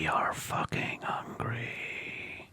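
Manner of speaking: slow, whispered beast hunger; inner beast voice. Acted. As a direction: rasp it out like a starving beast. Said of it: "That's, like, my inner beast voice".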